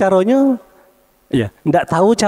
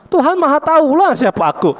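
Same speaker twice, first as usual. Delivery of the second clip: mocking exclamation; 10 semitones higher than usual. Mocking Pharaoh's question.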